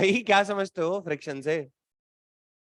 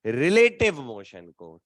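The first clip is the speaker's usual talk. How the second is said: slow, stress on "relative"; emphatic answer; 6 semitones lower, slower than usual. Shown as a demonstration. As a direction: lean on "relative", deliberately. Teacher repeats the key answer.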